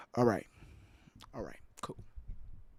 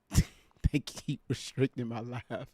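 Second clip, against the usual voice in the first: laughing; 3 semitones lower, 7 dB quieter, slower than usual. Real, not acted. Laughs about restricted live.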